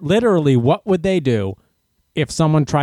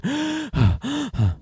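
high, noisy acted panting; out-of-breath gasping; 11 semitones higher, 5 dB quieter than usual. Runner acting out crossing the finish line, winded gasps. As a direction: gasp as if just over the finish line. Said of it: "I am so out of breath. I'm going".

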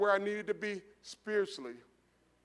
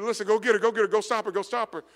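louder, pressing call to his daughter; urgent call; 3 semitones higher, 8 dB louder, faster than usual. Retold or imitated. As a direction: hurry her on, go go go. Urging daughter to chase.

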